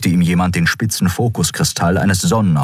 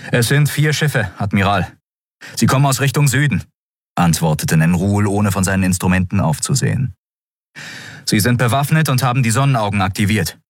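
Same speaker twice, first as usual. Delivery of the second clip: quick, higher, urgent sensor readout; tense officer's report; 6 semitones higher than usual. A narrator's reading. Officer reporting the approaching ships to the admiral, fast and tense. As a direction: rattle off the numbers fast and tense.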